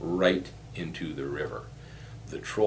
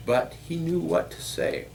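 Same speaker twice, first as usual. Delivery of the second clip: ordinary delivery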